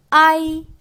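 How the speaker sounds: 아이 said high and loud, a stressed and 이 light and short; pinyin ai demo. Shown as a demonstration. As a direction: model 아이 as one gliding syllable with the weight on 아. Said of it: "아이 하고 발음을 해주시면 되고요".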